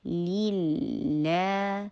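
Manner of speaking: للہ very slow, middle lam stretched; drawn-out "lil-laa" on للہ; lengthened recitation. Shown as a demonstration. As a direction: hold the long "aa" in للہ. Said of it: "لمبا کر کے پڑھا جاتا ہے".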